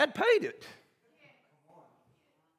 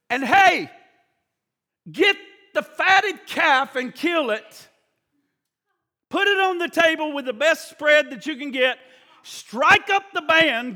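joyful orders; 4 semitones higher, 3 dB louder than usual. Acted. Father orders the party for returned son, jubilant shout. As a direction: call for the feast with delight.

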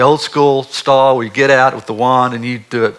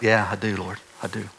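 tender answer; 5 semitones lower, 10 dB quieter than usual. Retold or imitated. Answering God in prayer.

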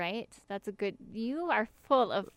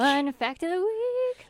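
announcer voice; 9 semitones higher, 4 dB louder, slower than usual. Acted. Cohost announces the show segment.

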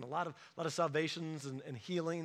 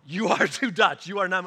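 teasing laugh; 9 dB louder than usual. Retold or imitated. Youth pastor teasing teen about being Dutch, laughing loudly. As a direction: tease him through a laugh.

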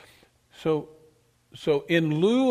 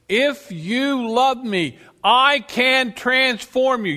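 fervent preaching; 3 semitones higher, 4 dB louder, slower than usual. Real, not acted. Preaching with building force.

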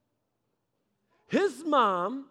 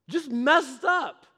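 slow emphasis; 4 semitones higher, 4 dB louder, much slower than usual. Real, not acted. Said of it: "emphatic repetition".

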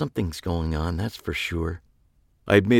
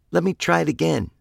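much higher, cartoonish squeak; squeaky voice from the gas; chirpy helium voice; 10 semitones higher, faster than usual. Still high on helium gas.

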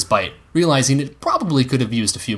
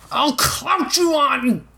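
higher, slow angry call; angry yell; much slower than usual. Acted. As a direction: shout after him, then stop. Dunk yelling after boy.

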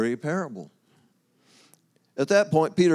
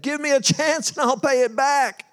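high, louder desperate plea; begging servant; 11 semitones higher, 4 dB louder than usual. Acted. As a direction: beg the king for one more chance. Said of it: "threw himself at the king's feet and begged".

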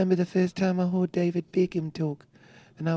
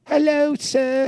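high, slow put-on English accent; a lilting, exaggerated English gent; posh English voice; 8 semitones higher, 3 dB louder, slower than usual. Cohost showing how everyone in England talks, in an English accent. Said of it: "everyone in England".

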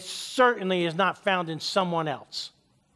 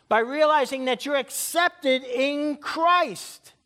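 preaching at full force; 8 semitones higher, slower than usual. Real, not acted. Preacher telling the congregation who they are, proclaiming at full force. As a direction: drive it home at the top of your range.

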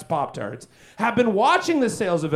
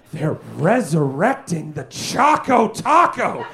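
ominous announcer; 7 semitones lower, slower than usual. Acted. Dramatic reveal in doom voice.

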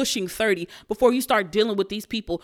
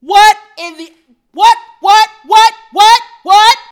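high, loud repeated what; stunned shriek of disbelief; shrieking what, what, what in pure shock; 10 semitones higher, 15 dB louder than usual. Real, not acted. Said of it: "I was shocked".

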